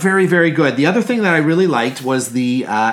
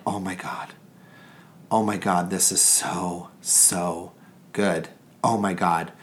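much softer, lower, savoring each word; hushed rapture; 4 semitones lower, 7 dB quieter than usual. Retold or imitated. Recalling eating dinner, softly blissed-out murmur. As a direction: murmur it like every bite is heaven. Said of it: "and I'm just going".

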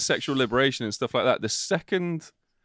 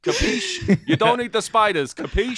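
high, loud, badly faked Jersey accent; bad American accent; 10 semitones higher, 5 dB louder, slower than usual. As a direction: ham up a poor Jersey accent. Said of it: "I'm shit at American accents".

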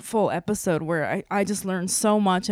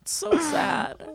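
real tears, high, half laughing; crying but laughing through it; tearful; 9 semitones higher, slower than usual. Real, not acted. Co-host telling Brian it's so sad, weeping. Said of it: "I'm getting emotional again".

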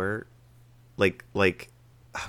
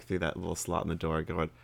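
usual speaking voice

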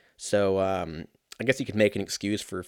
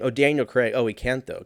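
ordinary talk

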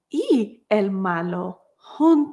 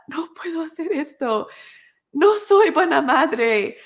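higher, pleading voice of a worn-out mother; despairing mother; 7 semitones higher than usual. Retold or imitated. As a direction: cry out that she can't do this, high and strained. New mother's despairing thoughts. (madre desesperada, voz aguda y suplicante)

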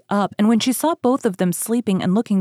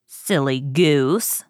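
low, slow witch; old woman scolding; 5 semitones lower, much slower than usual. A narrator's reading. Witch belittling puzzled Gretel, low slow scorn. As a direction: draw it out low, with disdain. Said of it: "said the old woman".

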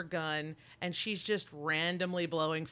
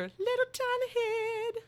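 much higher cartoonish squeak; squeaky tiny-head voice; 4 dB louder than usual. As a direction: play the alien queen's tiny inner head. Imitating alien queen's tiny head.

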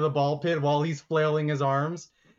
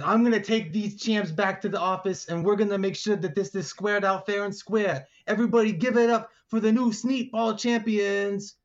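higher-pitched, projected ringmaster announcement; Big Notch; 6 semitones higher than usual. Acted. Big Notch presenting the new champions, announcer voice. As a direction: build to a big announcer's finish. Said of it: "he says".

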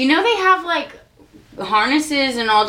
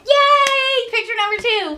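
excited cheer; 11 semitones higher than usual. Real, not acted. Cheering at picture two.

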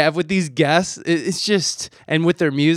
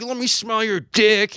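dog's eager demand, pitched higher; playful dog voice; 7 semitones higher than usual. Retold or imitated. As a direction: voice the nosy dog, a little higher. Voicing a pushy dog.

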